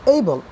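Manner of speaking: "এইবল" said short and loud in one go; "এইবল" in one unbroken burst; one-syllable demo. Shown as a demonstration. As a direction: say "এইবল" as a single quick beat, punched out. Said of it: "একবারই এটি উচ্চারণ করতে পারছো".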